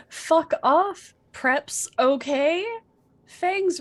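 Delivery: ordinary talk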